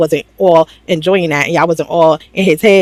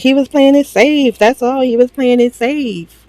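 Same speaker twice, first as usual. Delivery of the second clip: high, insistent echo from the sidelines; backup-singer chiming in; 6 semitones higher than usual. Retold or imitated. Anaya backing up Trinity from the background, high mock chiming. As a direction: chime in high, slowing on the repeat. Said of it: "like, the backup singer".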